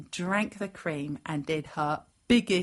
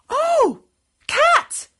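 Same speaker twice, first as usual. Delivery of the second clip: very high, loud gasping joy; overjoyed girl; 13 dB louder than usual. A narrator's reading. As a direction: exclaim it high and thrilled. Girl overjoyed to see her cat.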